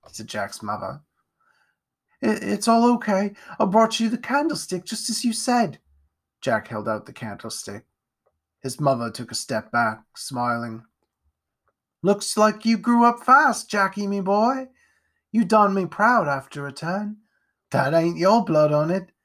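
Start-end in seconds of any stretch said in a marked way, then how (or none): 2.2-5.8 higher, hesitant lad's voice; young Jack. Acted. Jack stammering to his mother, higher lad voice. 12.0-19.1 put-on higher, louder rough old mum; Jack's mother. Mother praising her son, rough and proud.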